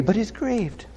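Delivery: hushed, pained delivery; grieved. Acted. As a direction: drop to a soft, sorrowful voice.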